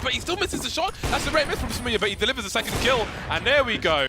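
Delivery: high, fast hyped call; excited. Real, not acted. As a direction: yell out the big play. Caster calling a miss, then kill.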